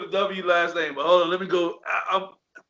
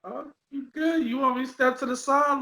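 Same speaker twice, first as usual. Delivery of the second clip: high polite offer; 9 semitones higher than usual. Retold or imitated. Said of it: "And I'm like".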